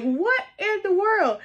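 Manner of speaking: high, drawn-out disbelief; astonished. Retold or imitated. Viewer recalling Hayden walking up, astonished and high. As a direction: replay your amazement, stretching it out high. Teller replays her astonished reaction.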